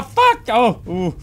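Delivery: sudden high, loud yelp; startled curse. Real, not acted. Player almost missing a jump, startled curse. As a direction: blurt it in alarm. Near miss in game.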